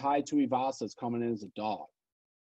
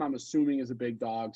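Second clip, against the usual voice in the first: ordinary talk, nothing marked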